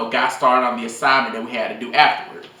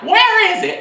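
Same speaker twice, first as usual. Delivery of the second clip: put-on very high, slow country-accented teacher voice; mock the teacher's country drawl, halting the student; 5 dB louder than usual. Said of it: "a little funny little country accent".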